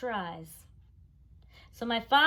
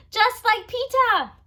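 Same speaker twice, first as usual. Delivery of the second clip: put-on much higher, loud, slow baby talk; proud toddler; 13 dB louder, slower than usual. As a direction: show off proudly. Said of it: "he said".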